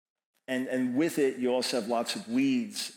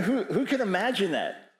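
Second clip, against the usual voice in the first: ordinary talk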